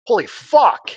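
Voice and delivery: loud, high shocked exclamation; jolted, high-pitched swearing; startled outburst. Acted. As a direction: burst out in alarm at a sudden crash. Acting startled by the crash.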